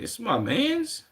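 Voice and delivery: high, slow delighted recognition; light up at spotting an old friend; happy recognition. Retold or imitated. Streamer recalls recognizing a friend's picture, high drawn-out delight. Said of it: "looking at the profile picture like".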